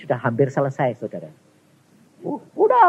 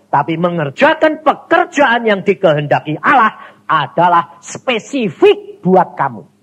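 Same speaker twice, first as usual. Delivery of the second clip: loud, slow, higher pulpit emphasis; forceful preaching; 8 semitones higher, 8 dB louder, slower than usual. Acted. Preacher driving home the difference to the congregation, loud and slow. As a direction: hammer the point home to the church.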